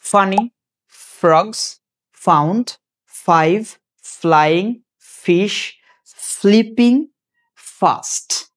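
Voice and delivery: "Funny frogs found five flying fish flipping fast" one word at a time with pauses, f held. Shown as a demonstration. Teacher asking students to notice the sound, word by word. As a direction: isolate each word to show the f. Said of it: "সাউন্ডটা খেয়াল করো".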